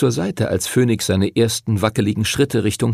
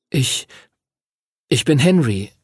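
put-on higher, halting boyish reply; hesitant Henry; 3 semitones higher than usual. A narrator's reading. Henry hesitantly introduces himself to the dragon. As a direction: stumble before saying your name. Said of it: "er zögerte".

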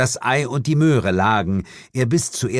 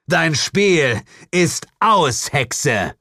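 laut und langsam, höher angesetzt; lauter Ausruf; 7 semitones higher, 4 dB louder, much slower than usual. Acted. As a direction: stell die Hexe mit lautem Ruf. Held stellt die Hexe. (laut und langsam: Dein Spiel ist aus)

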